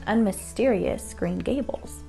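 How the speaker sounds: usual speaking voice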